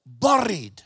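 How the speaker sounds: buried said the Scottish way, rolled r, "burr-id"; Scottish accent. Shown as a demonstration. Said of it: "I'm Scottish, so I say this word".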